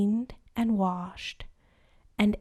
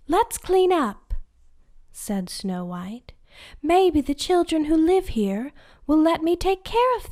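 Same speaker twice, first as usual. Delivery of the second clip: put-on high, bright girlish voice; sing-song eager princess; 6 semitones higher, 4 dB louder than usual.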